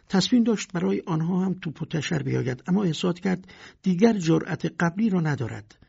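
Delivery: plain reading voice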